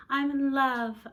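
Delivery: loud, high lovestruck declaration; dreamy announcement. Acted. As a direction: announce it swooning. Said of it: "announces Carolyn".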